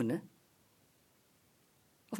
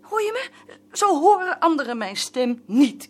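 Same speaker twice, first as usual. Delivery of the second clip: defiant; 3 semitones higher, 7 dB louder than usual. Acted. Wife defiantly rebuts.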